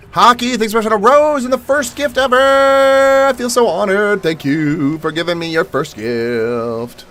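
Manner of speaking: high, slow, gushing gratitude; gushing thanks. Real, not acted. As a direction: sing out the thanks, high and drawn out. Thanking for stream gift.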